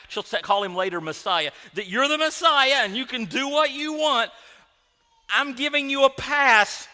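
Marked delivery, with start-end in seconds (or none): 1.7-6.9 high, loud, indignant concession; drawn-out, exasperated yelling; angry accusing Martha. Acted. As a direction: shout it as a grudging pardon. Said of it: "that's how she said it".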